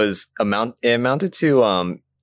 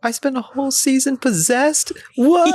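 high, baffled put-on voice; confused character voice; 14 semitones higher than usual. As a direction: play her confused and lost. Imitating Weiss, confused.

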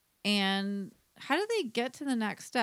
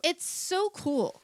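gushing; 8 semitones higher, much slower than usual. Real, not acted. Gushing over outfit.

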